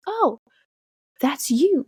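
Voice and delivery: high-pitched dawning recognition; mock surprise. Retold reaction of recognition.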